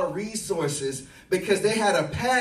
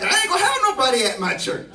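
laughing quote; 4 semitones higher, 9 dB louder, faster than usual. Retold or imitated. Pastor's complaint retold, laughing.

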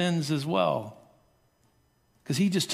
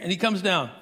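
ordinary talk

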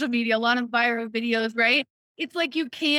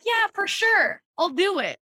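higher, gushing agreement; mock eager yes; 8 semitones higher than usual. You agreeing to friends on a whim, overeager yes. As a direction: say yes before thinking it over. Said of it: "And you're like".

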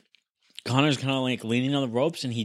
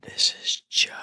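whispering; 6 dB quieter than usual. Real, not acted. Whispered intro after Rob.

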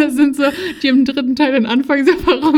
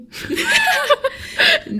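very high squealing laugh; real high-pitched laughing fit; burst of laughter. Real, not acted. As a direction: crack up, high and breathless.

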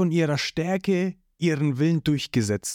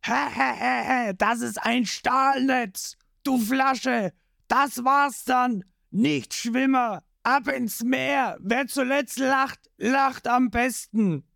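much higher, slow, cackling taunt; gloating villain; 9 semitones higher, slower than usual. Acted. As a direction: crow over the trapped hero.

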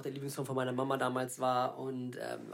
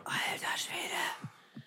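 slow amazed whisper; 6 dB quieter, much slower than usual. Real, not acted. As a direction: breathe it out in awe. Amazed whisper at domino fail.

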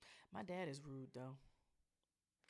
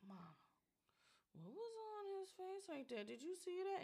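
high, very soft voice; hushed high-pitched mock child; imitating quiet child; 10 semitones higher, 13 dB quieter than usual. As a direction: ask it discreetly, small and high. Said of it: "she's just a quiet person".

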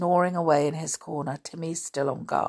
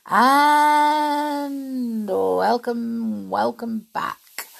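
drawn-out welcome; 6 semitones higher, 4 dB louder, much slower than usual. Shown as a demonstration. Reader greets listeners.

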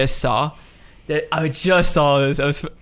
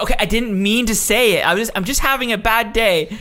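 mock Karen excuse; 8 semitones higher, 3 dB louder than usual. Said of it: "She's like".

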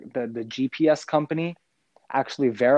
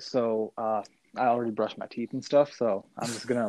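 ordinary delivery

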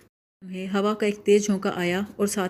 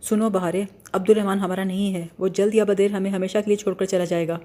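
ordinary delivery, nothing marked

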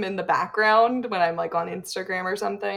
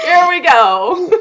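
excited; 7 semitones higher, 9 dB louder, slower than usual. Real, not acted. Starting accent game, psyched.

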